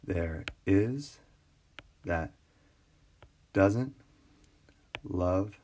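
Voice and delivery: "There IS that doesN'T love", in separate beats with pauses; halting syllable count, rising on the stressed beats; scanning iambic meter. Shown as a demonstration. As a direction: lift every second syllable, IS and the end of "doesn't". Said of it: "stress versus unstressed".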